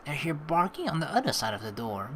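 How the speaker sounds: usual speaking voice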